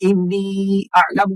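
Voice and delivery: slow chant, stretching the vowels of إني; slow measured Quran recitation of إني أعلم; drawn-out recitation. Shown as a demonstration. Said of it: "देखिए मैंने कितना खींचा".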